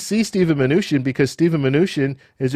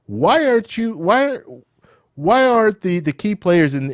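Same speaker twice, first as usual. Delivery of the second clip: higher, questioning reporter voice; quoting reporters; 7 semitones higher than usual. Retold or imitated. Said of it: "It's like".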